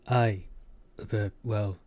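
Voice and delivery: halting, with gaps between words; stammering. A narrator's reading. Aki cornered by Kiva's guess about James, stammering. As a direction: hesitate and fumble for an answer. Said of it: "Aki stammered".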